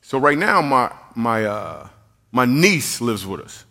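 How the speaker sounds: normal talking voice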